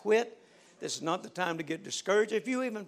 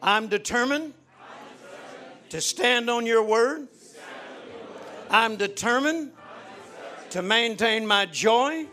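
led prayer; 5 dB louder than usual. Acted. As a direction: lead them in prayer, line by line. Leads congregation in prayer.

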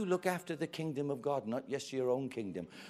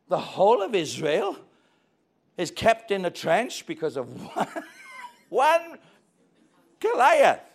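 indignant; 5 semitones higher, 11 dB louder, slower than usual. Acted. David indignant at the brothers.

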